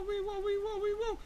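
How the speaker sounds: high, seesawing cries; acted wobbling panic. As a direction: teeter and squeal as if slipping. Slipping bear's bird-like wobbling cry.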